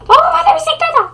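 put-on high, fast child plea; a boy pestering for a bike; begging kid. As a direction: son begging his dad for a bike.

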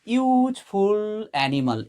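ইউজফুল said slowly, opening on a clear ইউ sound; pronunciation demo. Shown as a demonstration. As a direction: spell out the ইউ in ইউজফুল for students. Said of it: "ইউজফুল বলার সময় দেখো এখানে যে ইউ রয়েছে". (ইউজফুল ধীরে, শুরুর ইউ স্পষ্ট করে)

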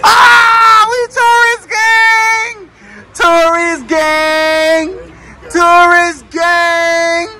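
high, drawn-out shouted cheer; gleeful high-pitched chant; excited celebration. Real, not acted. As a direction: whoop it out, stretching every word. Host celebrates shared star sign.

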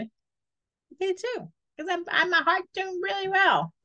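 high, happy newborn voice; baby voice. Pretend. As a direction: speak as a thriving baby. Baby voice scoring itself high.

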